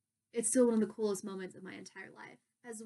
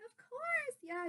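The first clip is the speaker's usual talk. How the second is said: easygoing agreement; 5 dB quieter, slower than usual. Retold or imitated. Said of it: "she's super so chill".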